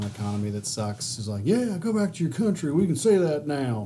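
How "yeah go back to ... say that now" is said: higher gloating voice; mock the smug follower; mocking Trump supporter. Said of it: "his blind followers ... is like".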